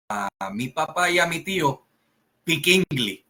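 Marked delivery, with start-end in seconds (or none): 2.4-3.2 "Piquingli" said as one blended word, pee-keeng-glee; the neighbour kids' garbled "Piquingli", all run together; Spanish-sounding "Piquingli" in place of Speak English; kids' mispronunciation. Retold or imitated. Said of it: "que en realidad era "Speak English".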